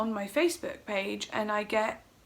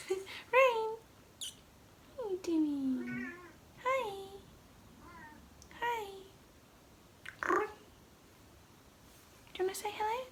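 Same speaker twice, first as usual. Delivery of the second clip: soft, high cooing; pet talk; 9 semitones higher, 10 dB quieter than usual. Real, not acted. Talking to pet playing with toys.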